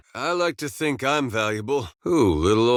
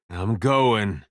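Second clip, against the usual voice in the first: ordinary talk, nothing marked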